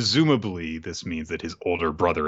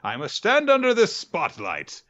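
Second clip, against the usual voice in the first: lofty, high theatrical pompous voice; dramatic villain voice; 10 semitones higher, 4 dB louder than usual. Pretend. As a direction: overplay a grand villain under a spotlight. Mocking a dramatic spotlight entrance.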